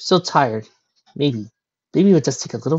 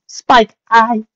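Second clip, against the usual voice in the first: high, hesitant unicorn lady; high nervous lady voice; 10 semitones higher, 9 dB louder than usual. Acted. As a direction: break off mid-thought, high. Rarity hesitating to confess.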